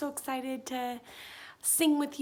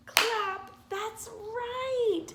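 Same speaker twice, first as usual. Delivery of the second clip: happy praise; 9 semitones higher, much slower than usual. Acted. Praising child's correct pattern answer.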